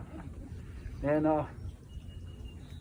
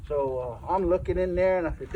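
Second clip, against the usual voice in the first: usual speaking voice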